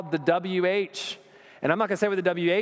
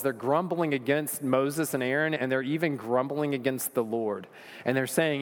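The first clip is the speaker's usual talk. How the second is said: usual speaking voice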